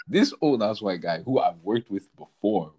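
ordinary talk

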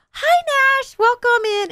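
high, sugary singsong greeting; sweet teacher voice. Retold or imitated. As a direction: greet a child brightly like a preschool teacher.

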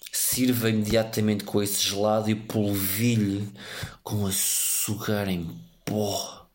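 unvoiced, slow creepy whisper. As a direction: whisper it like a stalker. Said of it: "voz creepy".

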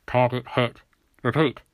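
military radio report in short phrases. A narrator's reading. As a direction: report it over the police radio. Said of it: "A voice came onto the radio".

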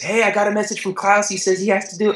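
a light send-up of the eager coach; imitating coach Harry. [name] voicing coach Harry relaying Klaus's message, lightly mocked. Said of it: "Harry's telling me this".

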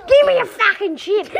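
rude seagull squawking for food, laughing; demanding seagull. Acted. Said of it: "they come to seagulls".

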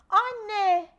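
put-on loud alarmed child's call. A narrator's reading. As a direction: shout for mum from across the house. Child calls mother in alarm. (annesine bağıran telaşlı çocuk)

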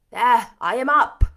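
loud, impatient retort; gruff Rochester character voice. A narrator's reading.

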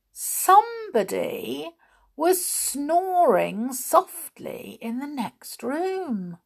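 high, slow storyteller's hush of discovery; suspenseful reveal. A narrator's reading. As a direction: stretch out the words to build suspense. Hushed reveal of a hidden sleeper.

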